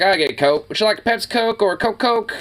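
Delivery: high mocking waiter asking; imitating a confused server; mock waiter question. Mocking a server's Coke question, high mock voice. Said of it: "It's like".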